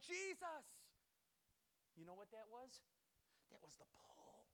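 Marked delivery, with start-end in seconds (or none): 0.1-0.7 high, very loud cry; joyful yell across the water; excited shout. Acted. Man jumps overboard crying out. 3.5-4.5 whispered, much softer reveal; breath-only emphasis; hushed whisper. Real, not acted. Preacher hushes to reveal the point.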